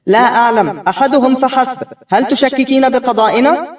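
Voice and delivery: the mother-judge scolding her daughter's doubt. Acted. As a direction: brush her off from the bench.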